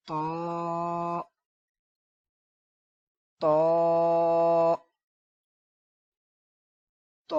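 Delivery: long, full-mouthed تو with a thick "to"; heavy letter sound. Shown as a demonstration. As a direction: hold تو thick, lips kept from rounding. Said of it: "موٹا کریں گے، ہونٹوں کو گول ہونے سے بچائیں گے".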